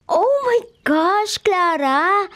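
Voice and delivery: slow, stretched surprise at her friend; shocked gasp. Acted. Friend spots yesterday's outfit, drawn-out surprise.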